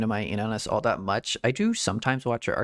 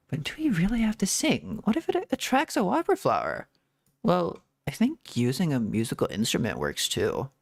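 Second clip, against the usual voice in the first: lightly voiced game characters, read out; casual dialogue read-out. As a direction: read the quest lines as a light, lively read-along. Reads game dialogue aloud.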